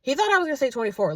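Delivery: ordinary talk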